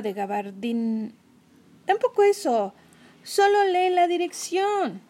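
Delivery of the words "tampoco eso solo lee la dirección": impatient. Acted. Kenny se impacienta.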